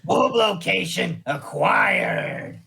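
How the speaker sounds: slow, shouted gruff voice; Spud voice. Pretend. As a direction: bark it out slowly in a gruff growl. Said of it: "don't do it in the Spud voice".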